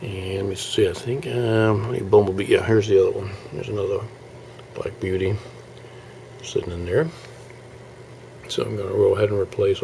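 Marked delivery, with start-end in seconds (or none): none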